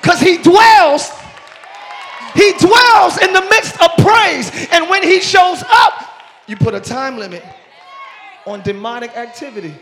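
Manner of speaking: loud, high preaching at full force. Real, not acted. Preacher rousing his congregation to praise, loud and high. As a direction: shout it to the back pews as the climax builds.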